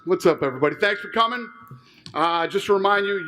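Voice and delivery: normal talking voice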